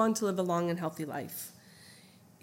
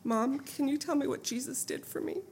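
scratchy weak voice; 5 semitones higher than usual. Retold or imitated. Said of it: "In a scratchy, weak voice".

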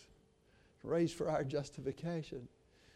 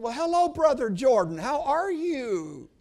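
loud, slow, put-on pious greeting; 7 semitones higher, 8 dB louder than usual. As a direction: drip false holiness onto every word. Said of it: "I put on a really pious voice that I didn't mean at all".

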